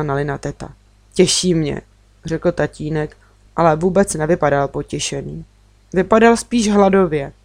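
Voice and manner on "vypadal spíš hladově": ordinary delivery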